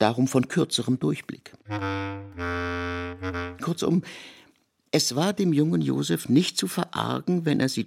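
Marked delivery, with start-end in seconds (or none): none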